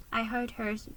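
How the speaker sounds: ordinary delivery, nothing marked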